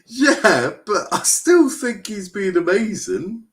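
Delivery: high, loud, drawn-out protest; stretched-out, high-pitched objection; indignant insistence. Real, not acted. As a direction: argue back slowly, voice way up high. Defends goalkeeper against co-host.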